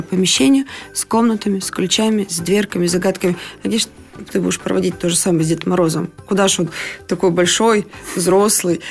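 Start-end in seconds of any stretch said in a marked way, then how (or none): none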